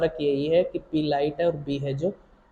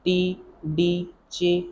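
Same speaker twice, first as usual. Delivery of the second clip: slow; much slower than usual. Shown as a demonstration. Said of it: "ये बना रहे हैं ना बेटा".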